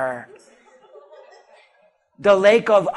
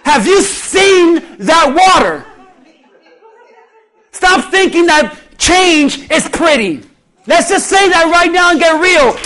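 loud, raised, drawn-out preacher's cry; preaching at full force; 6 semitones higher, 7 dB louder than usual. Acted. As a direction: hammer it home to the congregation. Preacher builds to shouting.

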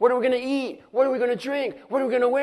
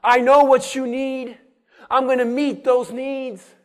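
voicing God; 5 dB louder than usual.

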